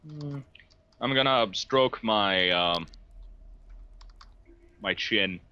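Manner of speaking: ordinary delivery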